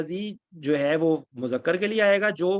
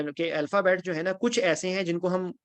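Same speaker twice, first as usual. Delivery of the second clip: normal talking voice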